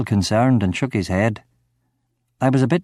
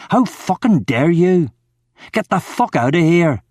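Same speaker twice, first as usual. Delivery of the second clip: furious; 6 semitones higher than usual. Acted. Drunk man orders Ramon out, furious. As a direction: throw him out in fury.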